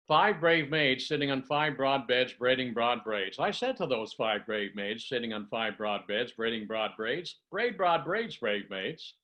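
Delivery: the whole twister on one deep breath; one breath, steady and unbroken. Shown as a demonstration. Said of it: "deep diaphragmatic breath".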